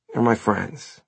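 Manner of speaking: ordinary delivery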